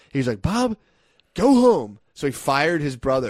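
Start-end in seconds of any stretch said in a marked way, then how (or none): 0.4-2.0 high, loud order to leave; angry firing. Retold or imitated. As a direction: snap the firing at his brother. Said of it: "Jax ended up getting so fucking mad".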